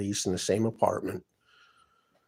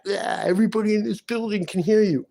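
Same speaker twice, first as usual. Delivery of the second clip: higher, louder sneering neighbour voice; mocking taunt; 10 semitones higher, 4 dB louder than usual. Quoting the neighbours taunting him through the wall, mocking. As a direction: jeer it like a smug neighbour.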